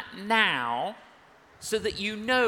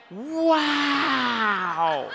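retold shout; 3 semitones higher, 5 dB louder than usual. Woman on plane amazed at the church, loud laughing shout. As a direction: blurt out "Wow" with a laugh. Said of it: "because she shouted so loudly".

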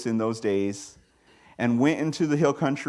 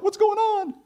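mock bewildered; 6 dB louder than usual. Mocking clueless believers.

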